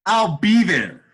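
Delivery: loud, high, emphatic I'll be there; eager acceptance. Retold or imitated. Teller accepting the Vegas job, loud and eager. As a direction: jump at the offer.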